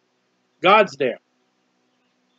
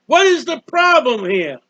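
loud, high pleading cry to the Lord; anguished crying out; 10 semitones higher, 5 dB louder, slower than usual. Acted. A man in anguish crying out to the Lord, loud and high. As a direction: wail it up at heaven in distress. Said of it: "he's in anguish and he's crying out".

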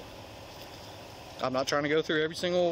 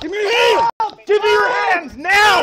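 barked order, much louder and higher, slowing on now; commanding shout; 13 dB louder, slower than usual. Real, not acted. Officer to struggling man, shouting a command. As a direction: shout the order to force compliance.

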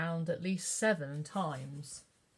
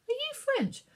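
put-on much higher little girl's voice; eager child question. A narrator's reading. Katie asks the lady, high and eager. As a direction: ask it bright and eager.

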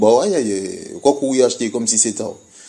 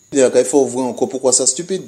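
ordinary talk, nothing marked

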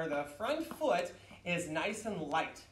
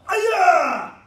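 high, explosive two-syllable kiai; martial arts yell; 12 semitones higher, 17 dB louder than usual. Shown as a demonstration. Teacher leading students after four blocks, full-force kiai yell. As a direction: yell at full power, falling away. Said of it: "Block, yell".

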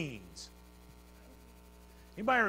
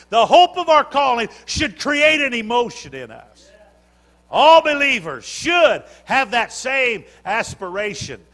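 louder, ringing preacher's cadence; full-force preaching; 6 semitones higher, 11 dB louder than usual. Acted. Preacher stirring the church toward heaven, full-force preaching.

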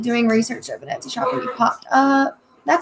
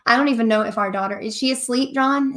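ordinary talk

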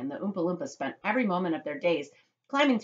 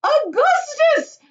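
very high, drawn-out yell; calling out; 11 dB louder, much slower than usual. A narrator's reading. Mother calling her son, calling out. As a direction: holler the name slowly and shrilly. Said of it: "called out Missus Gloop".

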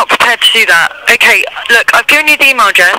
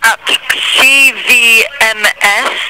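slow spelling; much slower than usual. Real, not acted. Spelling address letter by letter.

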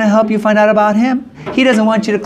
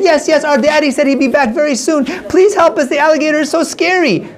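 put-on high childlike begging; urgent little voices asking for help; pleading children; 8 semitones higher than usual. Girls beg Jose for help, high childlike pleading. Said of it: "And so they said".

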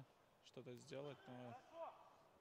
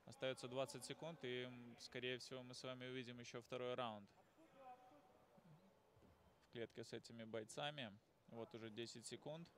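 live play-by-play; wrestling commentator. Real, not acted. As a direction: call the final seconds of the round.

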